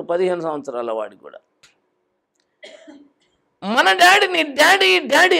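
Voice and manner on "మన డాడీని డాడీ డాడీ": high, slow drawn-out cry of daddy; calling out. Retold or imitated. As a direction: stretch out the call for daddy. (డాడీని గట్టిగా పిలవడం)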